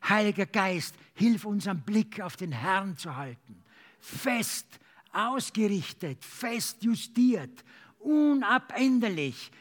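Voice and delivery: ordinary talk, nothing marked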